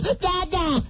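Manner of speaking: high mock toddler voice; young son. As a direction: imitate a small child calling daddy. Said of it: "my young son comes up to me and says".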